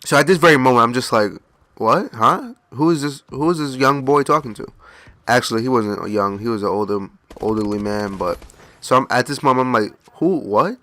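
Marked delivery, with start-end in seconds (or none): 1.8-4.7 high, questioning huh; puzzled, pitched up at the ends; confused double-take. Retold or imitated. Customer unsure who is calling him, confused. Confused reaction to being called by stranger. 10.1-10.8 rising, puzzled who-what; confused double-take. Retold or imitated. Customer wondering who called him, baffled.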